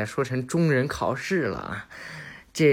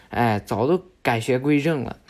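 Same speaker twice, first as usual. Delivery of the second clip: ordinary delivery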